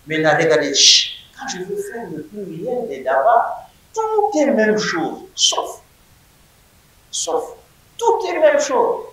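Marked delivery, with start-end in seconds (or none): none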